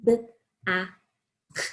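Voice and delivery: b, a, k with gaps between sounds; sounded out. Shown as a demonstration. Teacher spelling back for the class, sounded out. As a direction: say each sound of BACK separately. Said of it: "two letters that make the k sound".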